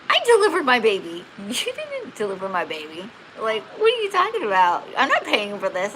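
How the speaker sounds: indignant retold protest, pitch jumping up; arguing. New mother disputing the hospital's delivery charge, indignant. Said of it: "And I argued with them".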